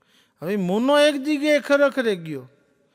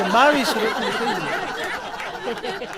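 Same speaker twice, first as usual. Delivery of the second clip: slow boast; 2 semitones higher, much slower than usual. Retold or imitated. Proud boast of twenty-two rotis.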